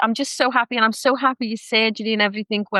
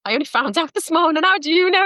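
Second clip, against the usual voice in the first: surprised client; 7 semitones higher than usual. Retold or imitated. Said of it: "they'll go".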